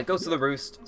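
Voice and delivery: ordinary delivery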